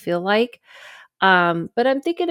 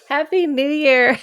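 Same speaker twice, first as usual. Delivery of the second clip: laughing; 7 semitones higher than usual. Shown as a demonstration. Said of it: "laughing repeat of greeting".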